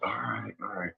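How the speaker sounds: near-whispered, reluctant giving in; very quiet retold compliance; resigned murmur. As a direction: give in under your breath.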